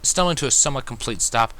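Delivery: ordinary delivery, nothing marked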